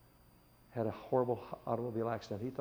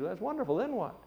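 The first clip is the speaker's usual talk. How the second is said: bright upbeat woman; 8 semitones higher than usual. Retold or imitated. Visitor repeats question higher.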